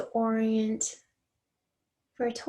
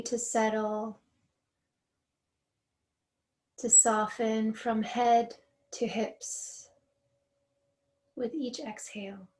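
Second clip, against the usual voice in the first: guided meditation. Real, not acted. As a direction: guide them gently into letting go, softening on each exhale.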